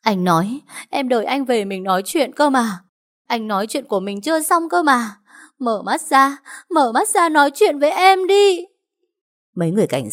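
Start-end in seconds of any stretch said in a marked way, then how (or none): 0.0-8.7 crying out. Acted. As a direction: beg him to open his eyes, breaking into tears. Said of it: "túm lấy người anh gào lên".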